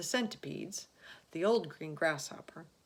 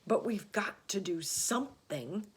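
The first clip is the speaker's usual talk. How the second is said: grim; 3 semitones higher, 4 dB louder than usual. A narrator's reading. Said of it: "announced grimly".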